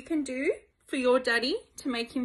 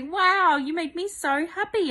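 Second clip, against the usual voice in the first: happy Dad; 7 semitones higher, 4 dB louder than usual. Pretend. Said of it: "wake up next to you and say".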